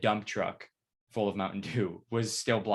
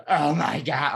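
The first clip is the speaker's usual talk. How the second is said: imitating friend's disgust; 5 semitones higher, 8 dB louder, slower than usual. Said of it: "She's like".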